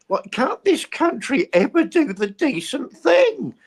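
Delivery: high, slow, outraged question; indignant. Real, not acted. Host fuming at the country over Windrush. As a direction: demand it in disbelief.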